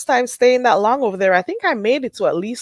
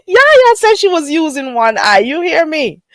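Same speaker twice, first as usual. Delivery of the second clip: high, loud, tickled disbelief; amused exclaiming; 8 semitones higher, 5 dB louder than usual. Real, not acted. Streamer repeating what drunk Yaya said, loudly amused. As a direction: exclaim it between bursts of laughter. Repeats funny line between laughing fits.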